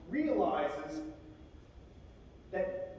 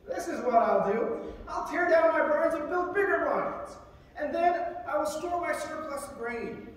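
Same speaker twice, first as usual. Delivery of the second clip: lightly acted, higher-pitched resolve; raised voice, mildly hammed-up planning; rich man's eager plan; 6 semitones higher, 6 dB louder than usual. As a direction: voice a parable character hatching a big plan. Rich man in parable makes his plan.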